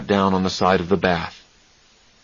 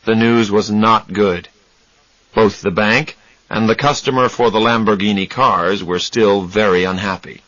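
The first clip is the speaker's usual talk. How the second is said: plain reading voice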